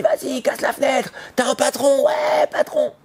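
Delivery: high-pitched, drawn-out shouts of mock hype; imitated street cheering. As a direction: egg him on like a rowdy street crew. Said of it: "Quand il sort dans la rue, on dit".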